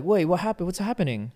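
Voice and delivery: urgent mock-replay of worry; retold alarm. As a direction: act out anxious confusion. Retelling alarmed reaction to dad's photo.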